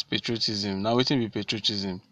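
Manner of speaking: ordinary delivery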